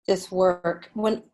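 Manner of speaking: normal talking voice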